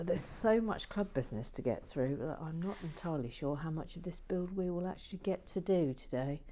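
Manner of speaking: ordinary delivery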